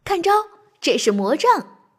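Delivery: mischievous, punchy cry; playful call. A narrator's reading. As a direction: call out a pretend magic attack, playfully. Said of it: "调皮地叫道". (调皮地叫喊)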